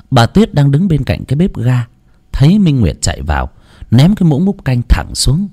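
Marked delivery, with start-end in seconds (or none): none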